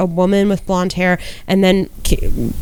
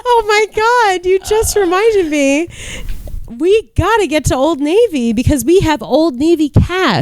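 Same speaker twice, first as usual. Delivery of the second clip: excited; 10 semitones higher than usual. Real, not acted. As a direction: gasp it out in sudden delight. Suddenly remembers the sale.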